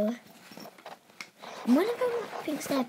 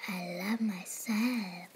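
whisper; 6 semitones lower, 4 dB quieter than usual. Real, not acted. Whispered aside.

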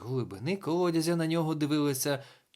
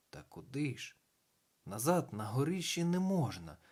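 ordinary delivery, nothing marked

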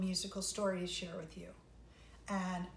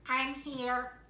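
quacking duck; 3 semitones higher, 5 dB louder than usual. Acted. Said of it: "quacked the duck".

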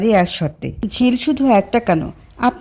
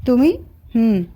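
plain reading voice

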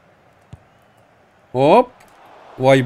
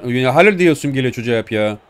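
usual speaking voice

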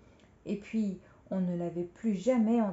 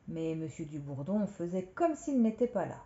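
ordinary delivery

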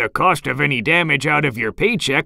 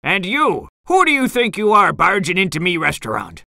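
fast, higher-pitched scolding; indignant challenge; 7 semitones higher, faster than usual. A narrator's reading. Crab boss to eel leader invading his restaurant, indignant. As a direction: confront the intruder as an irate boss.

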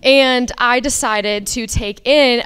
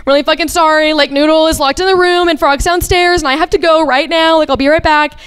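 higher, louder, urgent apology; flustered and raised, retelling an emergency; retold frantic call; 5 semitones higher, 5 dB louder, faster than usual. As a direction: relive a panicked phone call. Frantic phone call to friend.

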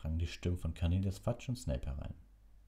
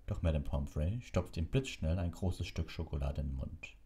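ordinary delivery

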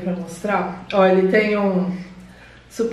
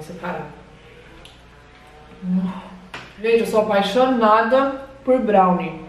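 talking between chews; slightly muffled chewing speech; mouth full while chewing. Real, not acted. Seen 2: Woman bit brownie at -3.0; mouth still working, hand near mouth.

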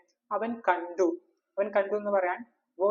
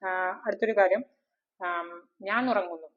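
normal talking voice

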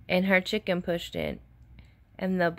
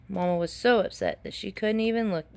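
usual speaking voice

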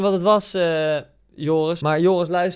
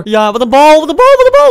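each "wat een bal" louder and much higher; building excitement; 9 semitones higher, 11 dB louder than usual. Real, not acted. Gamer hyping a pass, rising to a squeal. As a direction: climb from a cheer to a squeal.

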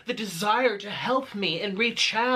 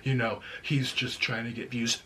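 low, mocking imitation of the critic; mocking his voice; 10 semitones lower, 4 dB quieter than usual. Ranting at a critic, doing his voice low and snide. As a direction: drop the voice and sneer his words. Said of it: "I'm so articulate".

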